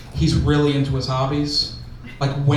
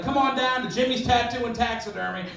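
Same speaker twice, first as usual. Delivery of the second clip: higher-pitched singsong commercial voice; local ad pitch; 5 semitones higher than usual. Acted. Tattoo and taxidermy shop invites customers, in ad voice. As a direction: sell it like a small-town podcast spot. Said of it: "like, podcast spots".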